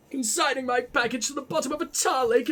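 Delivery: posh letter-writer's reproach. Acted. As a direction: read the accusation in a lofty posh accent. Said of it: "I gotta do the posh voice".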